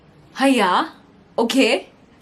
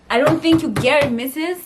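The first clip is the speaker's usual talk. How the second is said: higher, louder insistent retort; emphatic retort; 7 semitones higher, 7 dB louder than usual. Retold or imitated. Retelling arguing with her mom, higher and louder insistence. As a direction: push back hard on mom. Repeats line with more force.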